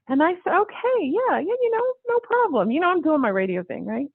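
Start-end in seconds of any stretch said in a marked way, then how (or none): 0.6-2.7 high, airy mock agreement; much higher, light dismissive okay; breezy brush-off. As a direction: toss off an easy yes you don't mean. Dismissively agreeing to consider modeling.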